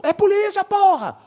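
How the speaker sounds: very high, held-out mock yell; acted insistent call. Plainclothes officer answers the uniformed one, very high insistent yell. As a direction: imitate calling back emphatically from afar.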